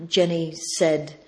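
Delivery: ordinary talk, nothing marked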